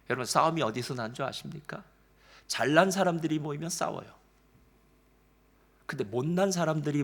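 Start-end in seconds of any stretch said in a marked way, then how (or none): none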